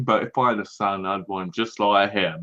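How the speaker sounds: teasing copy of a friend's clumsy line; mocking imitation. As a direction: parody the line back in a put-on voice. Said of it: "That was bad".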